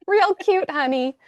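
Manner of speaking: high, sweetly patronizing; condescending pat on the head. Retold or imitated. Host voicing the ancient land, mock condescending and high. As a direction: talk down like to a cute child. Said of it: "land patting her head".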